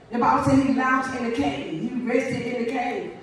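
ordinary delivery

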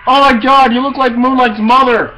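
high loud shocked cry; shocked shout. Real, not acted. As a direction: blurt it out in shock. Shocked by game character.

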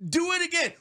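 high, exasperated cry of disbelief; exasperated outcry. Real, not acted. Host ranting about a repeated blunder, exasperated outcry. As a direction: pitch it way up in outraged disbelief.

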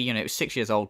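ordinary talk

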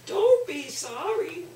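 put-on high squeaky old man. Shown as a demonstration. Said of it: "squeaky voice".

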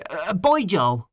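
sudden call out. A narrator's reading. O'Connor calling after the departing Hynes, sudden call. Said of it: "called out suddenly".

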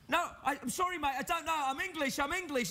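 high, pleading mock English voice; frantic high-pitched apology, acted out; panicked Englishman. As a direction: imitate a scared Englishman begging off. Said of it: "an Englishman expects on Hollywood Boulevard".

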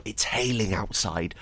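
normal talking voice

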